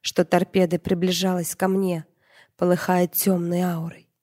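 ordinary delivery

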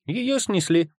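high-pitched, bold reply; brave comeback. A narrator's reading. Boy brazenly fibbing to his doubting father, brave and high-pitched. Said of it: "храбро ответил я".

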